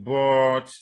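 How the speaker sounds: long, loud "but" held on one note; level intonation. Shown as a demonstration. Teacher illustrating how it keeps going, level intonation. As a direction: keep "but" going without rise or fall. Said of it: "that level".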